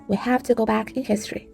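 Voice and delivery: usual speaking voice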